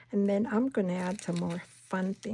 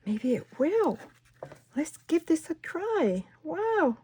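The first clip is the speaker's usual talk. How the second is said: higher, louder bursts of delight; excited; 6 semitones higher, 4 dB louder than usual. Real, not acted. Crafter thrilled her first try works, excited. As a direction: let the excitement spill over. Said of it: "I'm sorry if I sound so excited".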